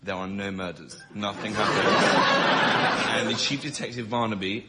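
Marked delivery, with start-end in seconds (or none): none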